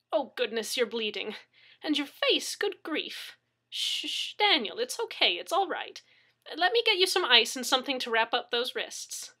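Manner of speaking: high, swooping anxious fussing; fussing mother hen. A narrator's reading. Neighbor tending his injuries, fussing motherly. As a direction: fuss and soothe like a mother hen. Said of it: "fussing over him like a mother hen".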